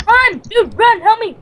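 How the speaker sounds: high, loud cry for help; urgent shout. Pretend. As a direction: yell to your teammate to run. Calling for help in game.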